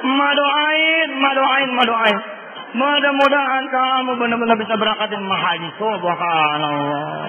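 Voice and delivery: acting an overcome mother praying for her child; tearful mother. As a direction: draw out the words in an acted weepy voice. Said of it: "Pasti dia nangis".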